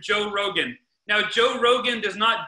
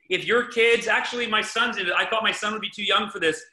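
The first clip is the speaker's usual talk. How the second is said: ordinary talk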